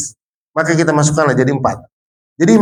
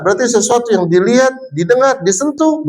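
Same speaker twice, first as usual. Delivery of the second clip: lightly voiced sweet teacher, higher; kindergarten teacher voice; 7 semitones higher than usual. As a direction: talk sweetly to small children. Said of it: "seorang guru mengatakan gini".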